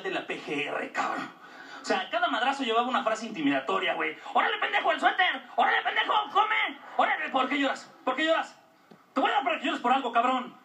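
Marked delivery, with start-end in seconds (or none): 4.3-10.5 angry yelling. Retold or imitated. Father figure yelling at child.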